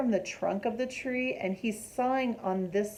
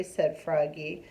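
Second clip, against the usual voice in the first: plain reading voice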